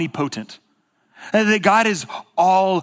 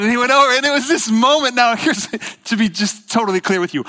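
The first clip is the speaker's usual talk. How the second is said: excited; 7 semitones higher, 4 dB louder than usual. Real, not acted. Excited build to story climax.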